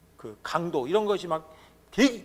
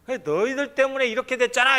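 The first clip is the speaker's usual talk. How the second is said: higher, louder resentful blaming; mock blame; 6 semitones higher, 4 dB louder than usual. Israelites blaming Moses and Aaron, mock angry. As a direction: throw the blame at them, annoyed. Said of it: "이런 거예요".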